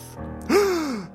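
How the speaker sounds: high gasp. Acted. Crowd gasps at villain.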